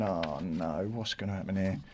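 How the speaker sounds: low, very soft thinking to himself; hushed, low aside of dread; anxious inner thought. Retold or imitated. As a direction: drop right down into a private worry.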